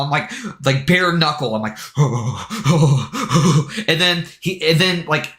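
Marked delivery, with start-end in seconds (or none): 1.8-3.8 repeated shaky puffs of breath; tense huffing. Retold or imitated. Gamer bare-knuckling the final attack, tense huffed breaths. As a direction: act out nervous panting under pressure. Tense, about to have a seizure.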